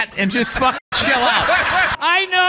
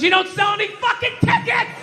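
ranting shout; 5 semitones higher than usual. Real, not acted. Comic ranting at the TV about empty soccer seats, shouting. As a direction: yell it like you're screaming at the TV.

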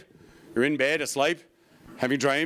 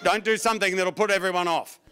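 ordinary delivery

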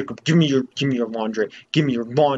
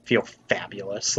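soft, lower, preening; vain Link voice; 3 semitones lower, 6 dB quieter than usual. Retold or imitated. Voicing Link admiring his reflection, soft and preening. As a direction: coo it like a vain pretty boy. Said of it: "Link is just like".

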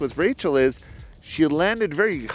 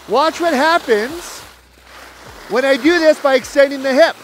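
raised, louder voice over the wind while skiing; live on-slope coaching; 6 semitones higher, 5 dB louder than usual. Real, not acted. Ski coach calling instructions to students mid-run, raised and loud. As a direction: call it out loud as you ski the drill.

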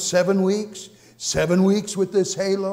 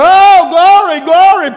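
high, loud, drawn-out cry of glory; ecstatic glory; 12 semitones higher, 17 dB louder, slower than usual. Real, not acted. Preacher overcome in the car, crying out glory.

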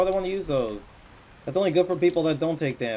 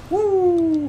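loud high victory whoop; 9 dB louder than usual. Real, not acted. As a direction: whoop it out in triumph. Cheer after the kill.

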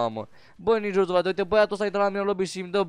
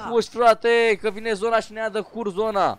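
urgent warning; 3 semitones higher than usual. Real, not acted. Player urges teammate to flee the zone, urgent warning. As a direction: cry out the warning to a slow teammate.

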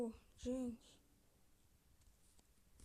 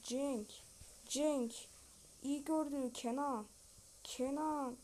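usual speaking voice

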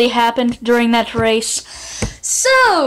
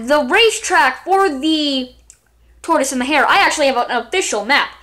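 normal talking voice

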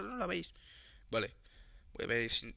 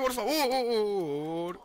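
very drawn-out, wavering begging; wailed plea; much slower than usual. Real, not acted. Player begging Javi to stop, drawn-out wail.

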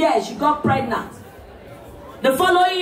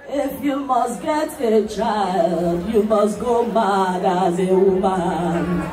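put-on much lower, very slow, half-sung old man's voice; old medicine man; 8 semitones lower, much slower than usual. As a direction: intone it like an old village sage, slowly. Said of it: "the man told them".

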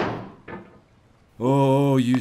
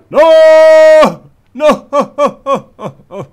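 high, much louder cry of no with a laugh on the second; anguished yell; 13 semitones higher, 13 dB louder than usual. Real, not acted. Gamer yelling no as he falls again, high and loud. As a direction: shout in despair then crack up.

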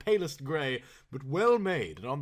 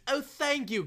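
grateful cry; 12 semitones higher than usual. Acted. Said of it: "cried Mister Saltena".

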